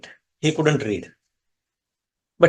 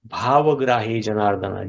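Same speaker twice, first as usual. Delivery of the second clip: ordinary delivery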